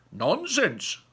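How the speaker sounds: a haughty prince brushing it off; shouted. A narrator's reading. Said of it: "shouted the prince".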